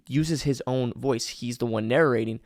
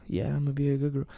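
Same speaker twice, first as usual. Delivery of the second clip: put-on eager girl; 2 semitones higher, 4 dB quieter, faster than usual. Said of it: "So I might say".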